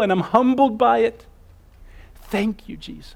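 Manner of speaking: awed whispered-down prayer of gratitude; humble grateful prayer. Retold or imitated. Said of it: "it leads us to saying".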